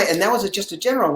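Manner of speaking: ordinary delivery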